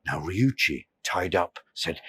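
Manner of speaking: usual speaking voice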